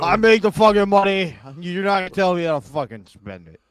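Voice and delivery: loud, high-pitched bluster; hammed-up domestic outburst; mock angry rant. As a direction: shout it like a sitcom husband. Acted husband quarrelling with wife.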